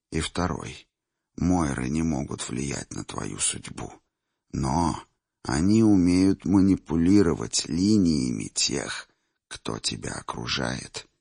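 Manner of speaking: put-on low, quiet old seer; old man voice. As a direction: speak softly as an old man. Old seer gives advice.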